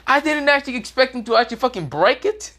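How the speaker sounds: high, sheepish mock voice; sheepish excuse. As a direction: squeak out an awkward excuse. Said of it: "Shikadai is like".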